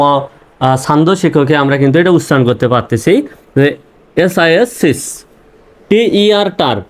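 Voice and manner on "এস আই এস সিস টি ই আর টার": each part spelled, then sounded, "সিস" and "টার" kept apart; teacher's syllable breakdown, pausing between "সিস" and "টার"; split into syllables. Shown as a demonstration. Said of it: "ভাগ করে উচ্চারণ করতে পারছি".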